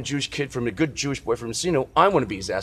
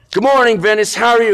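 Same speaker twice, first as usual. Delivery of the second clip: bright, much louder and higher hello to a stranger; cheery loud greeting; 11 semitones higher, 9 dB louder than usual. Retold or imitated. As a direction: call out a cheerful good morning. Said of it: "And I went".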